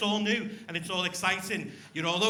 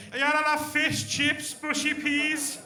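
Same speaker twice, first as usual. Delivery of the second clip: mock chip shop voice; 5 semitones higher, 4 dB louder, slower than usual. Comic imitating a chip shop order.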